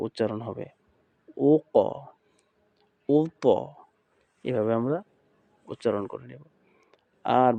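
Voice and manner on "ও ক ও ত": ও ক, ও ত with heavy echoed endings; upward articulation. Shown as a demonstration. Teacher models sounds for students, upward echoing bounce. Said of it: "উপরের দিকেও যাবে".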